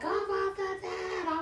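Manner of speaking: high imitation of her own screaming in labor; retold scream. Woman in traumatic labor, mother sitting on her chest, imitated high screaming. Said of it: "I just kept screaming like".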